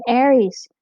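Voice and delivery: loud, high shout of a name. Acted. Gregor calling his bat Ares, sudden loud shout. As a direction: call out sharply across the cave. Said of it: "He shouldn't have shouted".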